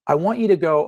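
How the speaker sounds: ordinary talk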